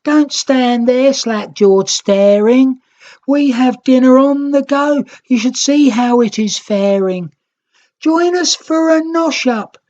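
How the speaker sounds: high, loud calling voice. A narrator's reading. Greens calling out to the Rednicks, loud and high. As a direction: call across to the staring Reds. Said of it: "The Green Nicks called out to them".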